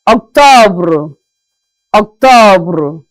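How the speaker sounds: Octobre with the R sounded out; French R. Shown as a demonstration. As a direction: repeat Octobre, stress the R. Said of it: "the pronunciation of the R is out".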